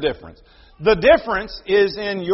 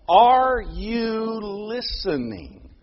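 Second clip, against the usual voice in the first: 1 slow pointed question; much slower than usual. Acted. Preacher asks congregation pointedly.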